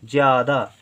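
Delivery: normal talking voice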